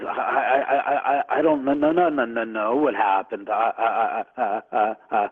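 rattled, repeated stuttering syllables; shaken stammering. Retold or imitated. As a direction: imitate a friend too shaken to speak. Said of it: "shaking like a leaf on a tree".

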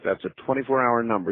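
ordinary talk

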